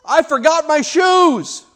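put-on high little girl's voice, calling from the car; a small daughter calling out to her mother; child calling out. Daughter calls to mom from the car, high child voice. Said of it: "little Emily from the car suddenly yells out".

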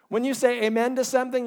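ordinary talk

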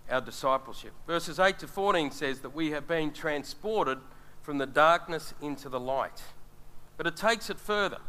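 normal talking voice

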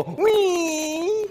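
a high, far-off little squeak of smallness; tiny squeak. Retold or imitated. A believer beside a holy God gives a tiny high squeak. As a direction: shrink to a tiny high whimper. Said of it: "you just go".

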